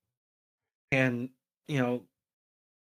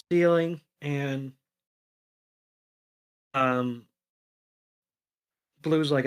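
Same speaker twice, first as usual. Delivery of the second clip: plain reading voice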